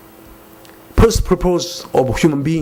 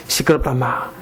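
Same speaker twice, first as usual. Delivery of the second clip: Gyeongsang dialect; 3 semitones lower than usual. Retold or imitated. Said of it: "경상도 말로 응답하시니까".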